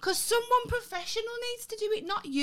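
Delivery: high-pitched insistence; indignant protest. Real, not acted. Demanding a professional instead of co-host, high and indignant. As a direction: insist with voice pushed right up. Arguing over who shaves her hair.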